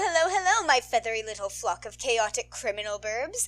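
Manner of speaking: ordinary delivery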